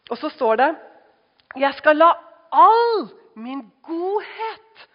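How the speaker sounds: high, loud scripture reading; preacher reading God's words, loud and high; loud preaching. Acted. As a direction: ring out God's promise.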